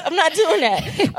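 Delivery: high, slow words through laughter; laughing refusal. Real, not acted. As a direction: crack up while refusing.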